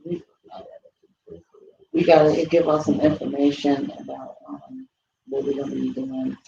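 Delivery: hushed, half-whispered; whispering on the phone. Real, not acted. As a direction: keep your voice down. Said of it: "Why are you whispering?".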